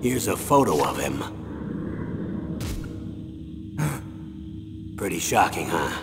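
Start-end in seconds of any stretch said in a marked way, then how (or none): 1.3-4.9 shocked gasp. Acted. Shocked at twin's photo.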